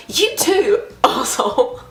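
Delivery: high mock-sweet reply with a laughed insult; sarcastic comeback, breaking into a laugh; rude retort. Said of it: "I shouldn't have been that rude".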